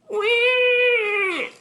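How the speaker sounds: put-on high, loud baby "wah"; long, piercing mock infant cry; imitated baby wail. Baby starts crying in story.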